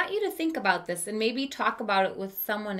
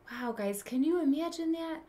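plain reading voice

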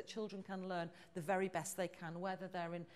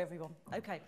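ordinary delivery

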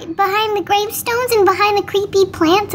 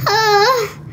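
ordinary talk, nothing marked

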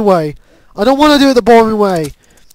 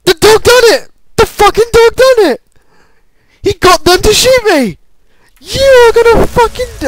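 higher, outraged protest; indignant outburst; 8 semitones higher than usual. Real, not acted. Gamer blaming the dog for getting shot at, indignant.

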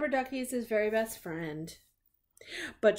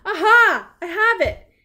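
much higher, very loud triumphant cry; triumphant aha; 10 semitones higher, 11 dB louder than usual. Acted. Sherlock finally solves the balloons, crying out triumphantly. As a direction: shout it like a sudden eureka.

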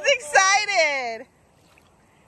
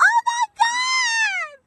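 very high, slow drawn-out oh my God; overjoyed; much slower than usual. Real, not acted. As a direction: stretch it out in sheer delight. Dog swimming, overjoyed.

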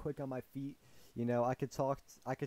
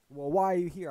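raised, higher mock-angry challenge; imitating furious man; 6 semitones higher, 8 dB louder than usual. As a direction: demand it loudly, as the angry man. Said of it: "he was furious at just us".

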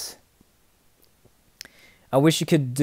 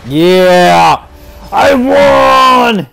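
loud, high, drawn-out triumphant yell; victory shout; 8 semitones higher, 10 dB louder, much slower than usual. Real, not acted. Streamer celebrating a game win, exultant shouting. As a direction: scream it out in real elation.

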